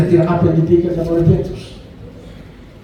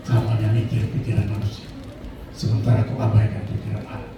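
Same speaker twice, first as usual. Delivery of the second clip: Jesus quoted; 9 semitones lower, 5 dB quieter than usual. Retold or imitated. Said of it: "Lalu Tuhan bilang".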